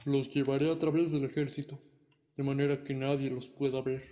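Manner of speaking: a dragon striking a friendly bargain. Acted. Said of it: "dijo el dragón".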